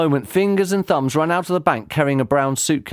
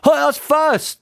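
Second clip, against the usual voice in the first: snapping; 13 semitones higher, 4 dB louder than usual. A narrator's reading. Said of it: "snapped Dad".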